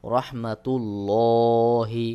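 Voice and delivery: "রহমাতুল্লাহি" with heavy, long-held "lo"; thick, full-mouthed lam. Shown as a demonstration. Teacher gives the example, "রহমাতুল্লাহি" slow with heavy lam. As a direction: slowly round out the L in "রহমাতুল্লাহি". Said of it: "পোর করে পড়তে হয়".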